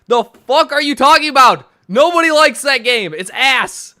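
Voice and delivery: loud, high-pitched incredulous rant; angry outburst. Real, not acted. Disputing a defense of Metroid Prime four, angry outburst. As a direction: yell it as a blunt dismissal.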